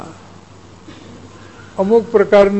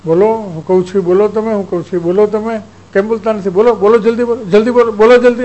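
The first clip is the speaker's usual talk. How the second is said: building command; 3 semitones higher, 6 dB louder than usual. Retold or imitated. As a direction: imitate a minister pressing harder and harder to make him talk. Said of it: "એવી રીતે માનસિક pressure આપે". (મિનિસ્ટરનો હુકમ, ધીમેથી શરૂ થઈ જોરદાર બૂમ સુધી વધતો)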